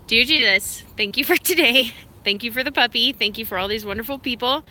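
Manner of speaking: a cheerful mock-solemn grace; half-playful prayer before the dog eats; mealtime prayer.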